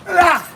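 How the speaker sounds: sharp bursts of effort on every strike; punch exertion cries. Real, not acted. Boxer throwing punches in training, effort cries. Punching through a workout round.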